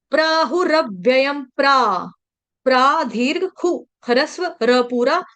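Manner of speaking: ordinary talk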